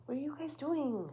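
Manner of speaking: high voice for the cats; talking to pets. Real, not acted. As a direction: talk up to the cats. Scolding her cats.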